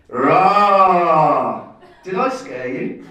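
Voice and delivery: loud "Rah!" then a slow teasing question; startling boo. Retold or imitated. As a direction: jump out and boo like a prankster. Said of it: "better than".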